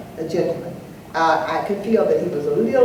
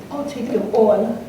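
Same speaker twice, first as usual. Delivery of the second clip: put-on British accent, drawn out and higher; mock-British, take your time over it; lightly playful British affectation; 4 semitones higher than usual. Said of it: "in my British accent".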